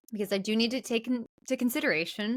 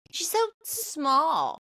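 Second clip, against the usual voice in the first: high, slow sneering so small; mock her catty drawl; mocking Judy; 5 semitones higher, slower than usual. Repeating Judy's jab at Angela, slow mocking sneer. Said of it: "What a weird thing to say".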